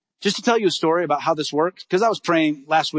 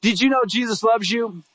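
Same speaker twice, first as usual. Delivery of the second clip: lightly voiced boy, a bit higher; a hint of an eager young teen asking; kid's voice; 4 semitones higher than usual. A ninth grade boy asking a stranger, lightly voiced kid. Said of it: "this kid points at me and goes".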